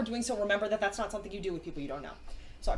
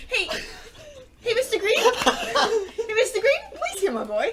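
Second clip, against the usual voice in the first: higher, laughing call to Mister Green; higher, laughing hammed-up plea; laughing mock plea; 10 semitones higher, 8 dB louder than usual. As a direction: call out and beg while giggling. Imitating the character's plea, laughing.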